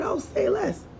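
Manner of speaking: high, airy sarcastic brush-off. Retold or imitated. To the coworker refusing the boy, a high sarcastic brush-off. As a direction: toss it off high and dismissive.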